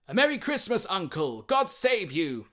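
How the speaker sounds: loud, high, joyful greeting, slowing at the end; cheerful cry. A narrator's reading. Nephew bursts in greeting his uncle, cheerful and loud. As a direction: call out a merry greeting. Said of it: "cried a cheerful voice".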